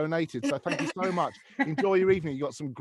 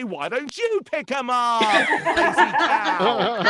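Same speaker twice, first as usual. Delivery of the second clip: higher, cheery advert voice, shouting at the end; ad-voice row; 6 semitones higher, 3 dB louder than usual. Retold or imitated. Husband answers wife's nagging in bright advert voice, shouting. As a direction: deliver the insults like a toy ad. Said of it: "And I say".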